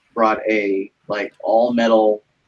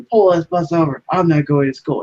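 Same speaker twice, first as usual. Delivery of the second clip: higher-pitched mock threat; retold kid's demand; 6 semitones higher than usual. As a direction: imitate a little homie making demands. Acting out kid threatening driver.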